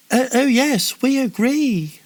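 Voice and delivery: high, loud frightened agreement; nervous exclamation. A narrator's reading. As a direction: blurt it out, too scared to refuse. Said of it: "exclaimed the satraps".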